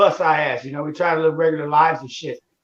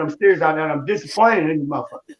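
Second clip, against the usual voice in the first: ordinary talk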